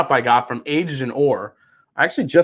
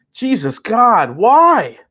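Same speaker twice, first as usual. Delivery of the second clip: anguished cry; 7 semitones higher, 5 dB louder, much slower than usual. Real, not acted. Disgusted after tasting.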